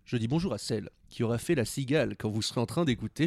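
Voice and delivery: usual speaking voice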